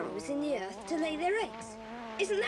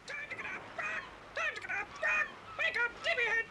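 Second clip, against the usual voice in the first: parrot voice; 7 semitones higher, faster than usual. Acted. Kiki the parrot squawking wake-up calls.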